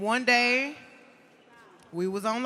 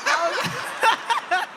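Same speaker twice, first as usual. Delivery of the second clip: higher, slow, breaking into laughter; laughing; 12 semitones higher than usual. Real, not acted. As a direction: talk through a real laugh. Laughing at her own story.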